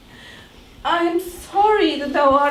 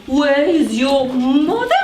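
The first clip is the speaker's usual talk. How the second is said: ordinary talk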